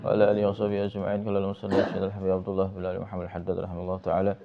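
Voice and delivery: fast, level-toned recited prayer; murid-style rote invocation, evenly chanted; opening prayer. Real, not acted. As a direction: run through the Arabic opening formula briskly on an even note.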